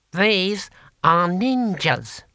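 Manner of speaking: high, very slow, drawn-out announcement; proud. A narrator's reading. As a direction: present them with slow, high pride. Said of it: "said Six Beneficent Winds proudly".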